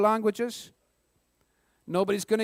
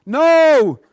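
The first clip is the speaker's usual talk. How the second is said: loud, higher-pitched booming no; emphatic rejection; 5 semitones higher, 10 dB louder than usual. Real, not acted. Quizmaster to contestant after a wrong guess, emphatic rejection. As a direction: shout down the wrong answer.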